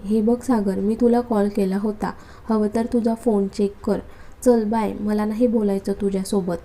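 plain reading voice

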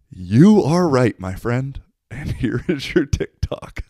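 slow, loud, theatrical declaration breaking into laughter; mock-grand. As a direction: announce it grandly, then laugh.

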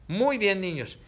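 ordinary talk

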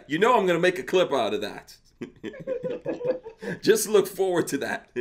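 talking through real laughter; laughing. Real, not acted. Host laughs as he teases his guest about a clip.

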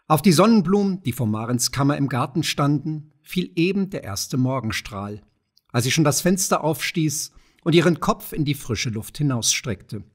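ordinary delivery, nothing marked

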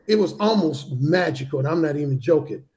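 usual speaking voice

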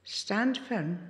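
ordinary talk, nothing marked

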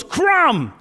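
sharp, high yell across the gym; coach yelling. Retold or imitated. Gridiron coach singling out a boy in dance class, yelling. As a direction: bellow the name at full volume. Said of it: "And then he yells".

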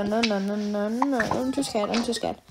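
ordinary talk, nothing marked

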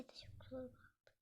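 soft conspiratorial whisper; hushed, guilty whispered agreement. Pretend. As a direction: whisper it like a shared secret. Whispered agreement after laughing.

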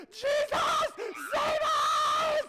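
hugely loud, high-pitched shriek; panicked scream. Acted. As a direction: scream for rescue at the top of your voice. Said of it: "shake you and scream".

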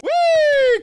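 high gleeful shriek; shrieking jackpot winner. Real, not acted. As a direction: squeal with delight. Flying jump, shrieking with glee.

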